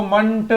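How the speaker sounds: मंट said with a short a, like mant; teacher models मंट with a short a; ment ending said as mant. Shown as a demonstration. Teacher shows pupils the ending मंट with a short a. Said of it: "आप उसको मंट बोलना".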